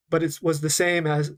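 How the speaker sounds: normal talking voice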